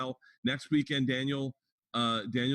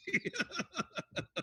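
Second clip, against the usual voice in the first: real laugh, very high and soft; cracking up at the punchline; 8 dB quieter than usual. Real, not acted. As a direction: genuine soft laughter at his own joke. Laughs at his own punchline.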